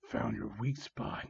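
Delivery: slow, low, hushed villain; whispered. Acted. Villain taunts victim close up, whispered. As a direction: whisper it slowly. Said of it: "he whispered".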